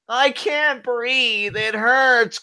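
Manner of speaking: high, slow, pained whine; whiny choked wrestler. Pretend. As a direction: play the wrestler begging for air.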